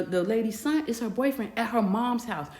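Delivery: normal talking voice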